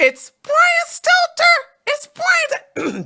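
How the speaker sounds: high, slow, drawn-out mocking call of the name; mock announcer shout. Streamer mocking the CNN anchor's sign-off, hammed-up high call. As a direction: belt the name like a mocking game-show host.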